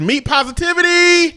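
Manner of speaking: very high, loud slogan cry; mock-triumphant announcement. Host announcing a silly slogan to co-host, mock-triumphant. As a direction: belt it out high like a rallying cry.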